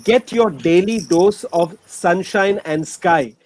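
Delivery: ordinary talk, nothing marked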